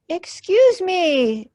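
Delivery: high, slow, overly polite greeting; polite monster. Acted. Monster politely greets the campers, high and slow. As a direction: ask it sweetly and slowly. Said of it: "said Monster politely".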